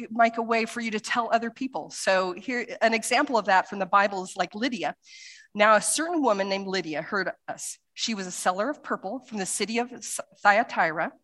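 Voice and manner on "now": normal talking voice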